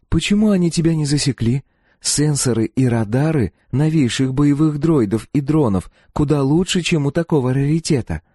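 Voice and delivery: put-on high-pitched young woman challenging him; questioning girl. A narrator's reading. Girl doubting how the old droid hid, questioning.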